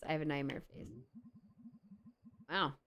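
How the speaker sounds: ordinary talk, nothing marked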